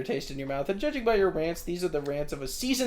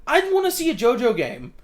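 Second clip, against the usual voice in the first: excited; 8 semitones higher, 8 dB louder than usual. Real, not acted. Excited wish.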